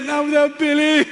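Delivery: high, loud mock-heroic vow; hammed-up loyal declaration; mock heroic friend. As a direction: play the loyal buddy refusing to leave. Friend's heroic reply retold.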